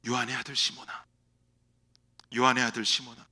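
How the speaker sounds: soft, low, tender calling of a name. Acted. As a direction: call him gently by his old name. Risen Lord calls Peter softly. (부드럽고 낮게 부르는 목소리)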